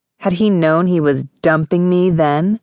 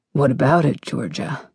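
husky; 2 semitones lower, 4 dB quieter than usual. A narrator's reading. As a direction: let the voice go husky to proposition her. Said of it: "His voice got all husky".

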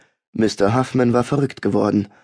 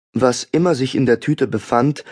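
plain reading voice

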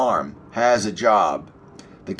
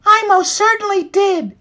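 very high, squeaky-pitched hen; high-pitched cartoon hen; Lola's hen voice; 4 dB louder than usual. A narrator's reading. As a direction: answer emphatically in a tiny high voice. Hen Lola answers in story.

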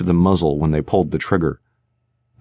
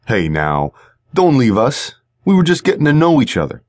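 mocking thug; 6 semitones higher than usual. Shorty mocks her leaving.

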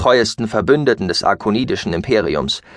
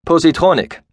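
calling out to the ship's computer; raised voice; 7 semitones higher, 4 dB louder than usual. Acted. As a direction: lift the voice to give a command. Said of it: "Rhodan erhob seine Stimme".

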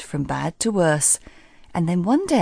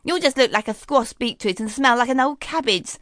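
mocking stepsister, lightly voiced; scornful Miranda; 5 semitones higher than usual. Miranda mocks Daphne before the ball, lightly scornful. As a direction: gloat over her sister, dismissive. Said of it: "snorted Miranda".

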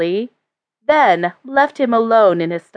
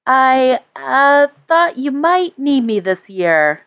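put-on hesitant young woman; 4 semitones higher, slower than usual. A narrator's reading. Granddaughter, hesitant.

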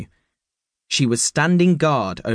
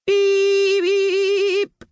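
very high, long robot beeps; foghorn-like beep; 3 dB louder than usual. A narrator's reading. A robot sounding its beep at Jake. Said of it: "A foghorn-like beep".

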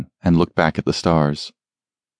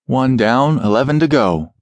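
triumphant; 6 semitones higher than usual. Acted. Hits an enemy fighter.

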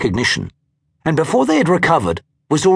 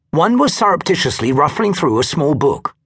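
ordinary delivery, nothing marked

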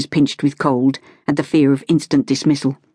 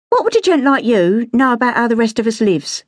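put-on high, indignant Cockney girl; angry street-girl retort, pitched high; 7 semitones higher than usual. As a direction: throw it back at the gentleman, stung. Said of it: "she said angrily".

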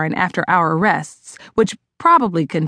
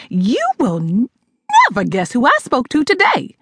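yelp; 4 semitones higher than usual. Acted. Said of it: "my mother yelped".

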